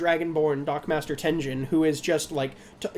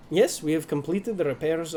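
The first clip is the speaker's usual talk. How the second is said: a light, accidental Russian tinge on yes, we have completed the repairs; slipping into Russian accent; 2 semitones lower than usual. Acted. Dockmaster voice slipping into a Russian accent. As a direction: meant as the dockmaster, drifting Russian. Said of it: "it's going Russian again".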